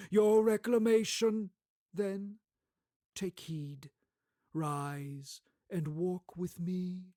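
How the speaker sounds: commanding, slow spirit voice; solemn spirit. Acted. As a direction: summon him gravely. Ghost of Christmas Past.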